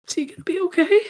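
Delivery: high, loud tearful voice; distraught question. Acted. Gecko asking if wounded friend will live, distraught. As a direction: ask it on the edge of panic.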